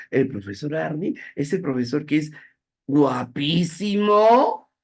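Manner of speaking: high, loud, slow guapísimo stretched with a rising tune; mock-admiring ironic compliment. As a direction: drag out guapísimo so it plainly means the opposite. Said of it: "se entiende que esa es una ironía".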